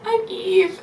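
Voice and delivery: re-enacted high, squeaky hello; fan's high-voiced greeting to an idol; high starstruck greeting. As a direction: pitch the introduction way up. Acting out nervously meeting her idol.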